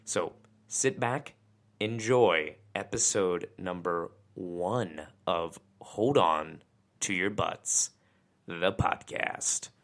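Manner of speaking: dramatic announcer. Acted. Dramatic intro sign-off.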